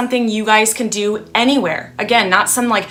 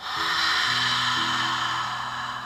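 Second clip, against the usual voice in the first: long foggy open-mouth exhale; ha breath out the mouth; 10 dB quieter than usual. Shown as a demonstration. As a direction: exhale as if fogging glass. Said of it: "let's just practice a few times".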